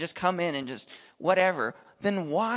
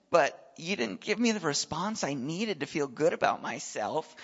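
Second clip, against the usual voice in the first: ordinary talk, nothing marked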